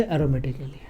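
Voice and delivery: whispered aside. Real, not acted. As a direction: drop the voice to a whisper. Sudden whisper in the lesson. (फुसफुसाकर)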